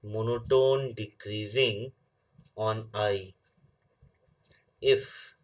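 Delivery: reads the definition slowly, word by word, for note-taking; slow dictation. Shown as a demonstration. Teacher slowly dictating a definition to the class. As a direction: spell it out slowly so students can write.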